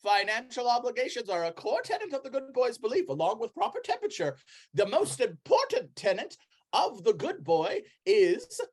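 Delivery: high, lively preacher of doctrine; zealous Brother Flufferkin. Acted. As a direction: proclaim the tenets with rising fervor. Voices zealous cult preacher.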